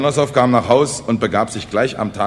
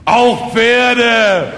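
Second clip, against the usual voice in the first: loud, high, drawn-out proclamation; proud conviction; 13 semitones higher, 9 dB louder than usual. Acted. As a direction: boom it out with full pride. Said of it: "sagte stolz im Brustton der Überzeugung". (stolz im Brustton der Überzeugung)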